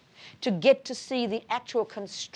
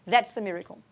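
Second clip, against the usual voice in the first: usual speaking voice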